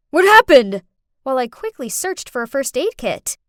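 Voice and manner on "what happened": loud, higher burst of alarm; frantic question. Acted. Father rushing in to his crying daughter, frantic. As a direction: call out in a panic. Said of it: "Ethan frantically asked".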